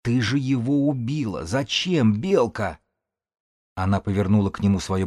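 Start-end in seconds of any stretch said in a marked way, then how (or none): 0.0-2.8 higher, drawn-out accusing cry; anguished reproach. A narrator's reading. A witness reproaches Белка for a killing, anguished. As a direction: plead for an explanation in dismay.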